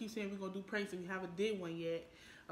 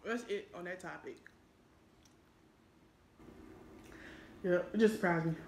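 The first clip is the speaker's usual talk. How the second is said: eating between words; talking while chewing; 7 dB louder than usual. Real, not acted. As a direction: mouth partly full of food. Seen 0: holds burger from 1.8s, chewing and licking lips throughout.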